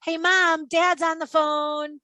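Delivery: a mock child's yell to Mom; kid yelling. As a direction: call out like a child across the house. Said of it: "he heard the kid yell".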